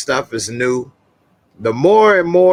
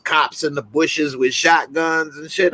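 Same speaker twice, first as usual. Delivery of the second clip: ordinary delivery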